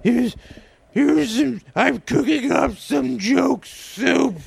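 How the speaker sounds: high, slow, laughing hag; witch voice. Pretend. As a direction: ham up the witch, cackling. Continues witch voice.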